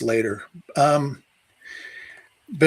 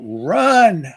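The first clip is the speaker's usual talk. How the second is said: retold shout, higher and louder; raised-voice yell from a retold scare; 5 semitones higher, 7 dB louder than usual. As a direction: yell it out like a sudden order to flee. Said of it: "I just yelled out".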